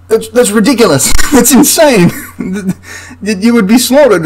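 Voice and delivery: high-pitched, laughing disbelief; incredulous laughing. Real, not acted. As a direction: scoff at it, laughing for real. Mocks the point-blank volley tactic.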